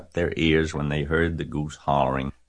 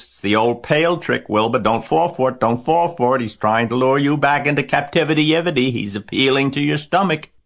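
high, loud pleading warning; urgent goose warning; 9 semitones higher, 6 dB louder than usual. A narrator's reading. Goose warns Wilbur of pail trick, urgently. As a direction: warn urgently in a raised goose voice.

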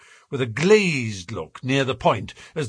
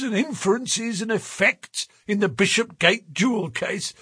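high-pitched, hearty, mocking policeman; wheezy blusterer; 7 semitones higher than usual. A narrator's reading. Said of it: "he wheezed".

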